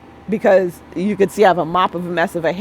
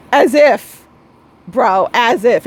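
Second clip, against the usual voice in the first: sassy dismissal; 4 semitones higher, 6 dB louder, slower than usual. Retold or imitated. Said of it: "I was like".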